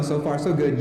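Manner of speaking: normal talking voice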